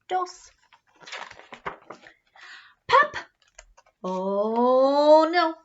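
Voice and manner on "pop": high, loud popping sound; high loud pop. Acted. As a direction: pop it out like a bursting button. Button pops off.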